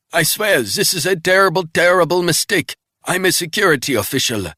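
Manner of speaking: high, loud, desperate begging; panicked pleading. Acted. Wounded man pleading with Resnick not to kill him. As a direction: beg for your life, frantic. Captive protests.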